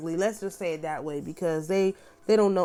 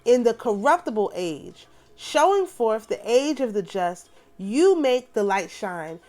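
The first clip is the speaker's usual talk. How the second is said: solemn, measured prayer reading; 3 semitones higher, 3 dB louder than usual. Acted. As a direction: pray it aloud as scripture. Reads prayer aloud.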